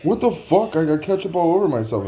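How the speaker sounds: high-pitched retold protest; indignant complaint. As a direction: protest high, acting out your outrage. Complaining to staff about ketchup.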